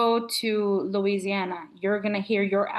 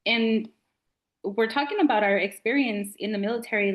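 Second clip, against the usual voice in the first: ordinary delivery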